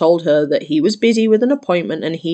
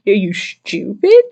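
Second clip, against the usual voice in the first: are you stupid; 2 semitones higher, 6 dB louder than usual. Real, not acted. Mocking rhetorical jab at Karen.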